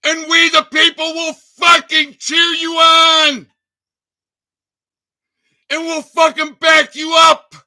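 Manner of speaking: a real rallying yell; pleading shout. Real, not acted. Urging veterans to act, high pleading shout.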